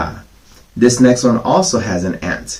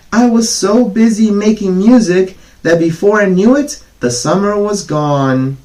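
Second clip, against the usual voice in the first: much higher, louder pleading whine; whiny grasshopper; 11 semitones higher, 4 dB louder than usual. Acted. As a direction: whine like a starving little bug. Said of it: "whined the grasshopper".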